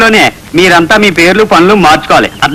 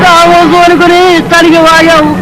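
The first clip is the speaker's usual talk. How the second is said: tearful complaint; 7 semitones higher than usual. Acted. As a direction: whimper out the sad tale like a wronged man. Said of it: "ఏం జరిగిందో ఏడవకుండా చెప్పండి". (ఏడుస్తూ ఫిర్యాదు)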